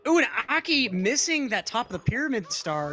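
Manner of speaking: startled rise on ooh; a live gasp of surprise; surprised. Real, not acted. Reacting to a missed star.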